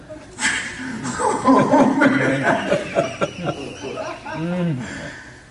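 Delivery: loud, high burst of overwhelmed laughter. Real, not acted. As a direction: laugh it off after the emotion. Speaker overwhelmed after story.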